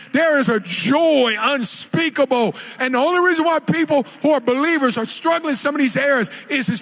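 fervent preaching to the congregation. Acted. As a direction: drive the point home. Preaching at full force.

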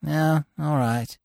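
put-on bored kid. A narrator's reading. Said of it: "said the kid".

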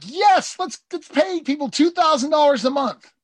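high, mocking Republican; hammed-up eager Republican; a Republican ham, pitched high. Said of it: "The Republicans like".

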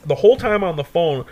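normal talking voice

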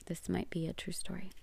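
a confiding whisper to the audience; whispered aside. Real, not acted. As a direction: slip in a secret aside under your breath. Whispered aside mid-lesson.

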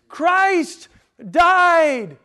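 CHRIST and DIED proclaimed high and loud, with a pause between; ringing, loud stress on each word, falling on DIED; added emphasis. Shown as a demonstration. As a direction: hammer both CHRIST and DIED as separate exclamations. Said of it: "adding to its emphasis".

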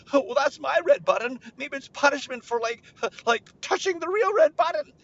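put-on high, loud kid voice; worried child. Child wonders if this is punishment, high worry. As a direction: fret in a high mock panic.

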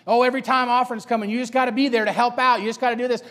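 lightly needling complaint; mocking. Peers making fun of Samuel's church commitment, mildly mocking. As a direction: needle him for helping out. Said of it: "Making fun of his relationship with the preacher".

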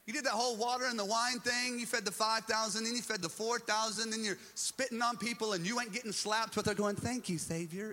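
high, loud, incredulous accusation; acted-out ranting disciple listing grievances; Peter. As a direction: pile up the complaints, exasperated. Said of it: "here's Peter going".

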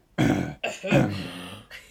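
deep growly throat clearing. Shown as a demonstration. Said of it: "a really deep, grovelly".

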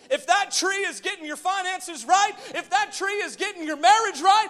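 high, loud building list; full-force preaching. Real, not acted. Preacher challenging the church on their lives, high loud preaching. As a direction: drive each condition upward at full voice.

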